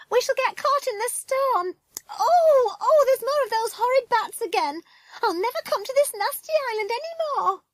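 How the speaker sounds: very high, wailing woman's voice; panicky cry. Acted. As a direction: wail in hammed-up panic and disgust. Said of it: "cried a woman's voice".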